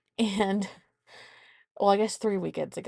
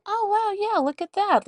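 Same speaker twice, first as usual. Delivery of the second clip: put-on child voice; 13 semitones higher, 5 dB louder than usual. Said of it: "And she goes".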